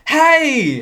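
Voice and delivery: high, hammed-up fake hello; bad actor's cheery hi; awkward greeting. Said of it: "no one here is an actor".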